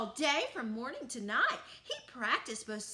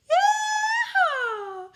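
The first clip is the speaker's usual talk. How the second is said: high, loud whooping yeehaw with a laugh; big joyful cowboy whoop; 11 dB louder than usual. A narrator's reading. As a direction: holler it like a cowboy calling the cattle in. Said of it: "cowboy call".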